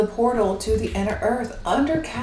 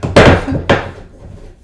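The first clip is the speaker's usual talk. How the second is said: loud breathy laugh at her own fumbling; burst of laughter; 9 dB louder than usual. Real, not acted. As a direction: let a big laugh burst out.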